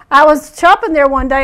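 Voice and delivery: ordinary talk, nothing marked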